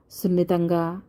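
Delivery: ordinary delivery, nothing marked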